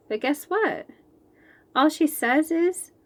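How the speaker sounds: ordinary delivery, nothing marked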